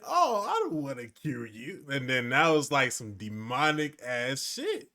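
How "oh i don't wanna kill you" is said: lightly imitating a cute bear; a hint of cutesy bear voice; cute adorable bear. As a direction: mimic a sweet, harmless bear. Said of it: "that cute, adorable bear and saying".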